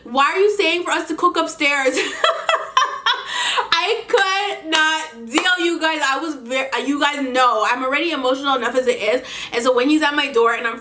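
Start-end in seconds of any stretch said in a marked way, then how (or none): 2.0-3.7 high airless burst of laughter; laughing hard. Real, not acted. Cracks up at the absurdity. 3.7-6.0 slow words through laughter; laughing. Real, not acted. Still laughing.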